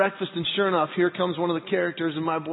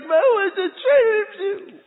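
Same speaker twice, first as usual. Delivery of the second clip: high, slow, drawn-out wail; mock crying child; 12 semitones higher, 5 dB louder, much slower than usual. Boys answering Dad at castle, mock crying. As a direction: imitate a sobbing kid's complaint. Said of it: "two children into Disney World crying".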